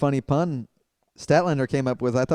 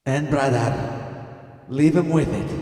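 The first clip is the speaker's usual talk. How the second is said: wrestler promo voice. Acted.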